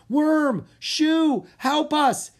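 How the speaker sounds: very high, loud yell for the dragons; yelling for help. Acted. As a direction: scream for help across the garden. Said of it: "Drake yelled".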